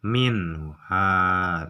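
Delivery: usual speaking voice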